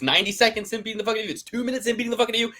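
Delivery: excited. Real, not acted. Heated argument about judging damage.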